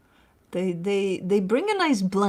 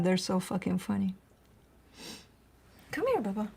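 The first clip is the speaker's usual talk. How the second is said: ordinary delivery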